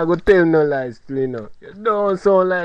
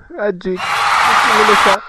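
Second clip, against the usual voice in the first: laughing; 3 semitones higher, 6 dB louder than usual. Real, not acted. Laughing to friend.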